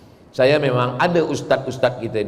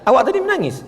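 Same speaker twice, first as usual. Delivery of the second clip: curious worshipper; 11 semitones higher, faster than usual. Retold or imitated. Worshippers asking each other.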